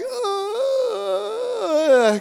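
high, sustained comic wail; long mock wail. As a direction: hold a hammed-up cry all the way down. Imitates own cry while lowered.

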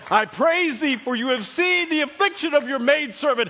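high, shouted parody of pious praise; hammed-up worshipful shout, building high; mock religious praise. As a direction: belt it out with overblown piety. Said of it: "one of these religious".

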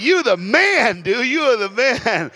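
high, loud, drawn-out retold cheer; hyped-up praise. Preacher cheering on the translator, retold excited shout. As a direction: shout it like you're hyping your buddy.

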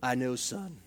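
low, soft, even father voice; calm engineer dad, quiet and level; a lightly voiced calm father; monotone dad. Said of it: "Very monotone".

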